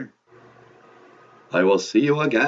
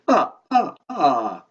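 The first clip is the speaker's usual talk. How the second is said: Count's laugh; 3 semitones higher, slower than usual. Acted. Count chuckling at the host, staccato vampire laugh. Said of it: "You don't have to laugh after everything you say".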